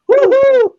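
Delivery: high, loud woo-hoo; excited whoop. Real, not acted. Host cheering the day's temperature to viewers, excited whoop.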